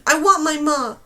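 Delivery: loud, desperate scream; screamed plea. Acted. Girl screaming at the storm wing for her mother, loud. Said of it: "she screamed".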